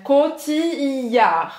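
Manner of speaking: Cotillard said very slowly, with a pause after "ti", as "koti-aʁ". Shown as a demonstration. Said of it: "Tem uma pausa entre os dois Is".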